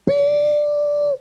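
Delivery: high, ringing, drawn-out "bing"; imitated bell ding. Comedian mimicking a bell at the top, high ringing tone. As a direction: ring out like a struck fairground bell.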